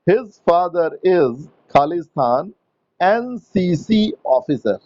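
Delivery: very slow, clear full-sentence read-back; teacher reading the finished sentence back slowly; slow dictation. Shown as a demonstration. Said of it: "dictating the full sentence".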